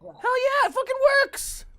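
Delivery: very high, loud, jubilant cry; gleeful yell. Real, not acted. Answering host about countering with Viagra, gleeful yell. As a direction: shout it out triumphantly.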